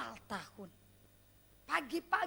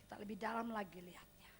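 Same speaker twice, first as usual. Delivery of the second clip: whispered invitation; 6 semitones lower, 7 dB quieter than usual. Real, not acted.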